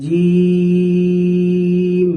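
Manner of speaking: teacher chanting জিম slowly; drawn-out letter demo. Shown as a demonstration. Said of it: "জিহ্বার মধ্যখান তার বরাবর উপরের তালুর সাথে লেগে".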